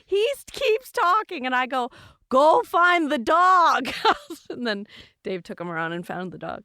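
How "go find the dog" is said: loud, high, drawn-out order; imitated yell, every word stretched; exasperated shout. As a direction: holler it slowly at a clueless neighbor. Exasperated order to neighbor, retold.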